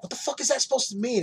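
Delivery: high-pitched mock panic; freaking out. Richie to a kid quoting his show, freaking out. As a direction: imitate someone freaking out at a kid. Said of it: "started freaking out on the kid".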